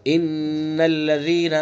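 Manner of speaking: slow "ان الذين" with nasal hum on the doubled n; slow ghunna demo. Shown as a demonstration. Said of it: "وہی غنہ کریں گے".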